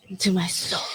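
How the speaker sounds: soft unvoiced whisper back; echoing whisper.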